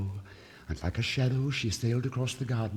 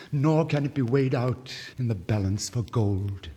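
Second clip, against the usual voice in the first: rapturous nightingale, louder, praising love; a little bird extolling love; 3 semitones higher, 6 dB louder than usual. Acted. Nightingale speaks.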